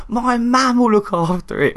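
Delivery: high, slow mock retort; high, slow hammed-up defiant reply; defiant patient. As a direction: answer back like the stubborn patient.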